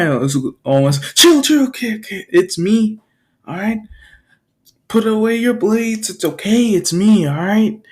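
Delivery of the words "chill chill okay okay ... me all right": high-pitched, urgent reassurance; frantic placating. Acted. Hero telling boy to put blades away, frantic. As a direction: talk down someone armed, voice pitched up.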